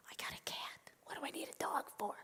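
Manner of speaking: hushed, whispery objection; puzzled protest. Acted. Old woman questions the rabbi's advice, hushed and puzzled. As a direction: whisper it in disbelief.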